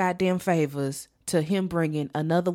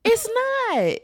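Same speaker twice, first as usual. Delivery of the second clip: emphatic high agreement; 4 dB louder than usual. Real, not acted.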